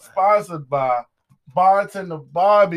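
slow, loud sponsor announcement; announcer read. Acted. Host announcing the sponsor, slow and loud. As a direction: stretch it out like a hype announcer.

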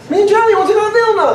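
imitating his wife, high and fast; a high, fast mock wife. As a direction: quote the wife's demand in a high voice. Wife voiced, mock demand.

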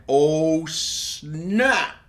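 loud surprised gasp of oh snap; shocked outburst at the TV. Real, not acted. Friend recapping the show, shocked oh snap. Reacting to psycho sister scene.

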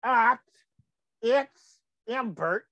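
slow letter-by-letter spelling; careful dictation of the address; spelled out slowly. Shown as a demonstration. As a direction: spell each letter so viewers catch it. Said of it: "to Embert".

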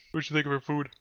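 high, loud sing-song pet talk; baby talk to pet. Acted. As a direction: coo at the cat. Talking to the cat.

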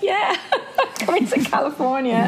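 retold eager yes, breaking into a laugh; laughing agreement. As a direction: laugh through the remembered yes. Retelling her keen agreement to move.

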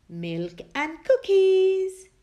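high, loud and slow, delighted; a happy grandpa treating everyone; cheerful grandpa. Acted. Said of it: "said Grandpa".

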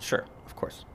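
whispered reply. Retold or imitated. Whispering an aside to the lady beside him. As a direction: breathe a hushed sure.

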